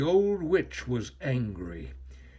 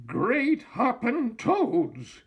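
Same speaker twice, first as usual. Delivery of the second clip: high, slow startled cry; cried out; 9 semitones higher, 4 dB louder, slower than usual. A narrator's reading. Old witch spots the dragon overhead, high startled cry. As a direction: cry out in amazement, drawn out high. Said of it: "she cried".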